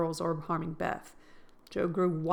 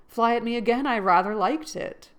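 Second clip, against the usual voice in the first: mischievous; 4 semitones higher, 7 dB louder than usual. A narrator's reading. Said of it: "looking mischievous".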